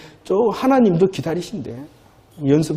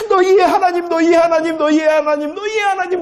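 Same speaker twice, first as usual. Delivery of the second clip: Moses' cry; 9 semitones higher than usual. Retold or imitated. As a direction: call it out like Moses at his farewell. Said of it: "그랬잖아요, 모세가".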